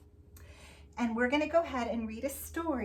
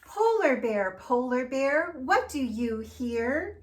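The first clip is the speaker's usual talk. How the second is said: sing-song storybook read; reading to children; 6 dB louder, slower than usual. Acted. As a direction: read it out to the little ones. Reads picture book aloud.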